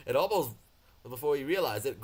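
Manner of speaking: usual speaking voice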